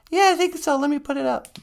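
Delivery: very high, bright little puppet voice; high cartoon helper voice; squeaky puppet sidekick. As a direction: pipe up as the tiny sidekick. Puppet sweetie answers host.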